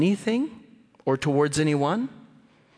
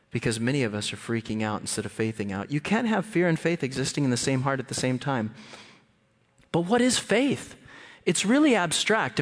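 ordinary talk, nothing marked

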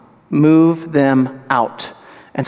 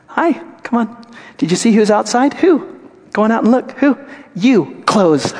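higher, jokey shooing voice; hammed-up, shoo them out the door; preacher joking as Jesus ushering people out, playful; playful mock Jesus; 7 semitones higher than usual. Jesus joked shooing mockers out.

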